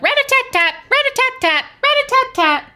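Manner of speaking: high, loud rapid-fire pecking; woodpecker call. A narrator's reading. Woodpecker keeping Owl awake, high loud tapping call. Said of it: "Woodpecker said".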